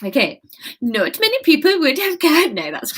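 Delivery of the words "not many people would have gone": put-on fumbling Swedish accent, slightly high. Anya telling Kate few would stay, in a shaky Swedish accent. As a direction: try the Swedish lilt on many. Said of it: "Swedish accent".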